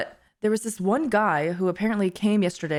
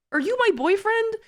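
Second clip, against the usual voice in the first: incredulous high question; 11 semitones higher than usual. Retold or imitated. Her own awkward question to the stranger.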